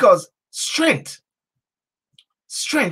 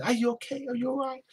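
high, hushed, fussing questions; lightly mocked solicitous voice, pitched up; concerned inquirer; 7 semitones higher, 4 dB quieter than usual. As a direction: imitate a worried caller, soft and high. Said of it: "Someone said".

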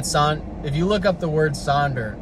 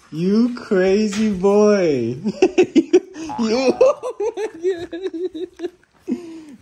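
delighted giggling coo; laughing at the pet; 10 semitones higher, 4 dB louder, much slower than usual. Real, not acted. To the cat on the crate, laughing high baby talk.

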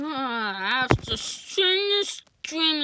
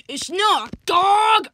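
loud, high cry of disbelief; shocked shout; 6 semitones higher, 7 dB louder than usual. Acted. Reacting to the talking dog, shocked shout.

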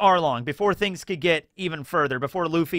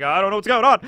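scared Usopp; 5 semitones higher, 6 dB louder, faster than usual. Retold or imitated. Usopp hiding under table.

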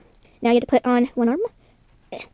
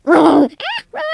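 frightened yelp; 5 semitones higher, 7 dB louder than usual. Acted. Character flees again.

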